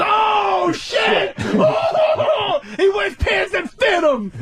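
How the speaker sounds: very high, laughing mock amazement; hammed-up crowd reaction. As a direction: whoop it up like a hysterical audience. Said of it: "everybody goes".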